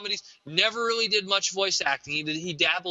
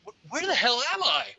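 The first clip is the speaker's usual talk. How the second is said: bewildered; 6 semitones higher than usual. Pretend. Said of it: "There's Bumper Robinson just like".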